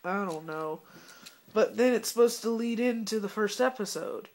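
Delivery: ordinary talk, nothing marked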